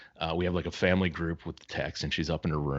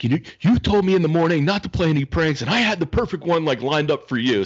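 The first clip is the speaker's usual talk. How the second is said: much higher, louder complaining voice; imitating his annoyed daughter; indignant daughter; 9 semitones higher, 6 dB louder than usual. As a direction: complain like a teen cheated of her prank. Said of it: "she's like".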